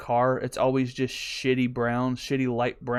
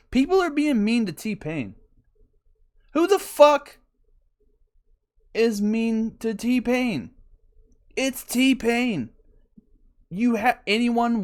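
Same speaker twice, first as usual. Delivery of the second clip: mock outrage; 8 semitones higher, slower than usual. Outraged defence of [name].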